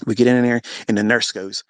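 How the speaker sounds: ordinary talk, nothing marked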